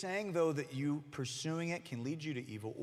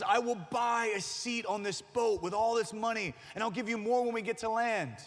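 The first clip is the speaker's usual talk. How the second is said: higher, louder pleading rich passenger; desperate rich man; 9 semitones higher, 5 dB louder than usual. Retold or imitated. Rich man on the sinking Titanic, pleading loudly for a seat. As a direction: wave the cash and beg for a seat.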